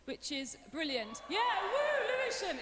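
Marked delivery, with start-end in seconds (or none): 1.3-2.6 high, loud burst of pretend hometown pride; playful high-pitched cheer; mock cheer. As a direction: whoop for your town as a joke. Mock cheer for her home area.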